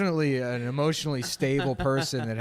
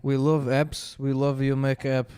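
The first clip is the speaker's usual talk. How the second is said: mock Israeli accent; 3 dB louder, slower than usual. Mocking Israeli officials' voice.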